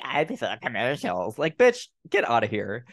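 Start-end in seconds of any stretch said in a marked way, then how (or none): 0.0-1.4 garbled, sneering mimicry of a snob; mocking parrot of a pompous remark; mocking pretentious. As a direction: mangle the words to mock someone pretentious. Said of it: "you pretentious little shit".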